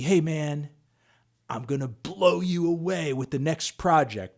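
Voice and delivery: lightly imitated confident promise; a hint of a slow, self-assured rapper. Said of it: "he said to me".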